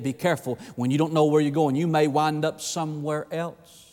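ordinary delivery

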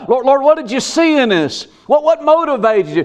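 high, pleading questions to God; pleading people. Retold or imitated.

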